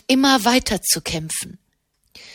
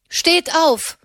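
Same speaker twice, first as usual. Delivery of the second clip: sharp raised command to a dying man; a commanding nun's voice, raised high; 7 semitones higher, 3 dB louder than usual. A narrator's reading. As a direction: order him up, high and firm. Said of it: "befahl Katharina nochmals".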